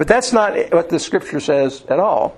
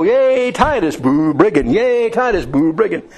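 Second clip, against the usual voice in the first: crowd cheer; 4 semitones higher than usual. Retold or imitated. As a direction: cheer the hero and boo the villain like a mob. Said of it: "they go".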